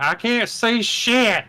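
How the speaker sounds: high, slow exasperated whine; drawn-out mock complaint. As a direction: complain like a fed-up moviegoer. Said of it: "We're like".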